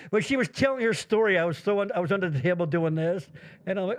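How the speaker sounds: ordinary delivery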